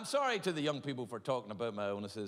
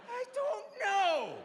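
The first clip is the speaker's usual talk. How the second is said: whiny; 4 dB louder, much slower than usual. Retold or imitated. Pitiful patient answers doctor.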